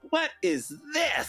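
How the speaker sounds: high, slow puzzled question; baffled what. Retold or imitated. To the podcast hosts about the album cover, high baffled question. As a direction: act stunned by the cover. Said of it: "it's like".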